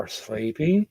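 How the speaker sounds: ordinary talk, nothing marked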